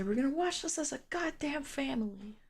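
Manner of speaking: soft, lightly voiced imitation of a friend; quoting a friend. Streamer quotes a friend about watching as a family, soft mock voice. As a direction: tuck the line into a quiet put-on friend voice. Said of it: "As my friend would say".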